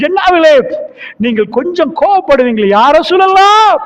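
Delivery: preacher voicing a companion's fervent cry to the Prophet; fervent exclamation. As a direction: burst out with fervour. Voiced dialogue rising to cry.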